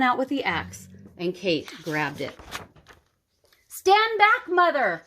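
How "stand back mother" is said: high, loud warning cry; high, loud urgent warning. A narrator's reading. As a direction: shout the warning to mother. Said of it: "Kate cried".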